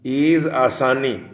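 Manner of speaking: ordinary talk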